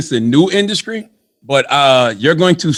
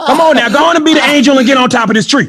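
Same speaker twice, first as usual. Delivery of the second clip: high, insistent country voice; hammed-up country cajoling; country accent; 7 semitones higher, faster than usual. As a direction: egg them on in a put-on country accent. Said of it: "Why is it always go to a country accent".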